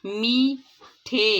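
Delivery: میٹھے stretched very slowly, each sound drawn out with a falling end; slow careful demo. Shown as a demonstration. Teacher drawing out میٹھے slowly for first-graders. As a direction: spell out میٹھے slowly for the class to copy. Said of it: "درست تلفظ کے ساتھ پڑھیں گے".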